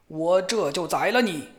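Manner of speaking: 恶魔略带凶气地咆哮; 威胁着吼出来. A narrator's reading. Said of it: "这个恶魔咆哮道". (恶魔对小伙子轻度咆哮)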